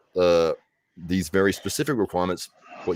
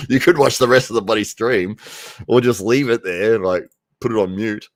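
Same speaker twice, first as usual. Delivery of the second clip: mock scolding; 5 semitones higher, 7 dB louder than usual. Real, not acted.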